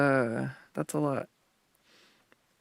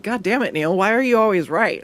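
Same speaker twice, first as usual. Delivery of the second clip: higher, louder mock-exasperated groan at being wrong again; playfully fed up, voice pushed up; mock exasperation; 6 semitones higher, 4 dB louder than usual. Mock frustration at her seal.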